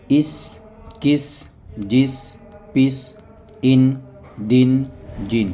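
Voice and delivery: each word said on its own, with pauses; reading drill. Shown as a demonstration. Teacher reads Qaida words to students, slow drill. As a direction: read the word list slowly for learners.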